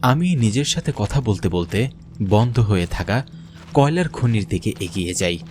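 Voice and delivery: ordinary delivery, nothing marked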